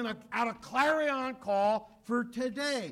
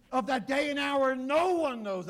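raised preaching voice; 6 semitones higher, 6 dB louder than usual. Acted. Quoting Jesus emphatically.

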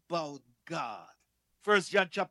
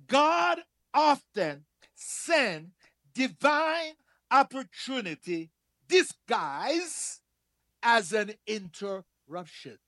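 much slower, weighting God and divine; slow repeat; slower than usual. Shown as a demonstration. Preacher repeating the saying for the congregation, slow and stressed. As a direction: drag it out so every word lands. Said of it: "Let me say it again".

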